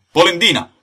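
loud, high, falling shout; insistent angry jeer; taunting insult. Acted. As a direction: fire the nickname back hotly. Trading insults.

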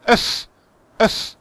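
"öff" cut short on a strong airy f; airy F demo. Shown as a demonstration. Teacher models the sound for a student, forceful airy "öff". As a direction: blow the f hard at the end of "öff". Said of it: "más aire".

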